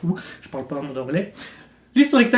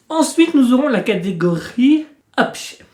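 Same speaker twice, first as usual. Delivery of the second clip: ordinary talk, nothing marked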